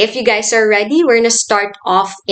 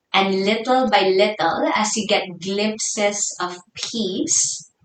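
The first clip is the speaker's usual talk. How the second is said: soft, hushed meditation guidance with long pauses; guided meditation; 6 dB quieter than usual. Real, not acted. Yoga teacher settling the class into their breath, soft guided meditation. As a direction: ease them inwards, softly and unhurried.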